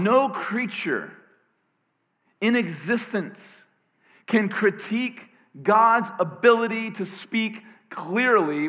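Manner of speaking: high, loud, emphatic sermon delivery; impassioned preaching. Real, not acted. Preacher decrying critics of God's speech, impassioned. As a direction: build with big slow stresses, then drive on.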